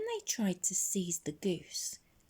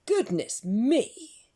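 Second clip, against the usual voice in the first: loud, slow startled farmer; startled awake; surprised; 6 semitones higher, 7 dB louder, slower than usual. Acted.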